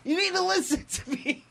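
high-pitched mock angry demand, half laughing; angry outburst. John slams the table at Cassidy, mock angry. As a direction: act out a slightly hammed-up demand. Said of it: "John slams the table and goes".

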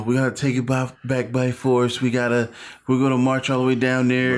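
rally the crowd in a mock politician's voice; Donald Trump. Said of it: "That's a great Trump accent".

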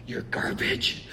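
hushed, breathless repeat; stunned worker echoing under his breath; whispered disbelief. Retold or imitated. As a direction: whisper it in quiet shock. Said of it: "the way he said it just kinda cracked me up".

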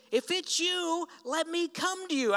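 eager Peter. Acted. Peter calling to Jesus on the water, eager and high.

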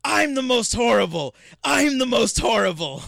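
shouted high and loud, gleeful; delighted scream. Retold or imitated. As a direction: yell it like a kid thrilled with herself. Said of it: "she screams with delight".

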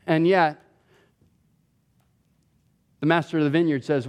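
ordinary talk

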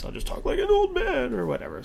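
high put-on old man voice; lightly voiced old man. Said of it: "put on a voice".

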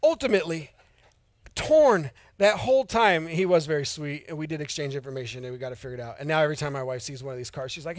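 normal talking voice

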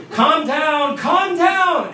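high, loud plea climbing on the repeat; exasperated shout. Retold or imitated. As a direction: yell over someone who is screaming. Husband shouts over screaming wife.

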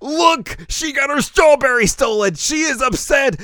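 high, loud vindicated outburst; high, loud indignant shouting to a doubting friend. Retold or imitated. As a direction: prove your point loudly. Indignant proof to Nodoka.

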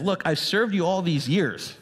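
put-on older son protesting to his father, a touch indignant; resentful older brother. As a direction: voice the bitter brother's grievance. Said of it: "It's as if he's saying".

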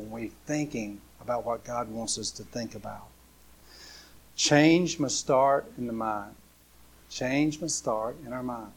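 normal talking voice

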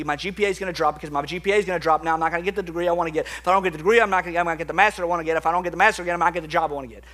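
rapid run-on fretting, imitating a student; mock panicked spiral. Crying student frets to pastor about her grades, mock panicked rush. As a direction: rattle off each worry straight into the next.

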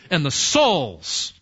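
drawn-out attempt at a Southern "souls"; badly faked Southern twang, slowly stretching "souls"; failed Southern accent. Shown as a demonstration. Preacher naming the parable, failed Southern accent on "souls". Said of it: "trying to do my southern accent there and failed".

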